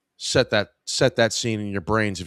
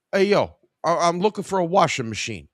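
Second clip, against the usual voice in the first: a deliberately bad Staten Island accent, higher and fast; 8 semitones higher, 3 dB louder than usual. The young customer asking for a washer in a bad Staten Island accent. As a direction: ham up a Staten Island guy shopping for a washer. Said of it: "probably the worst Staten Island accent you've ever heard".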